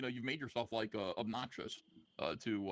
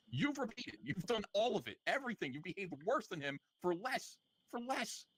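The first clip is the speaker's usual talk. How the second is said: scolding; 7 semitones higher, slower than usual. Real, not acted. Host scolding guest.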